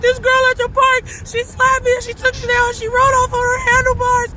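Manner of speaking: put-on a kid blurting it out through tears, pitched high; retold crying plea. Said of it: "And I'm just crying some more".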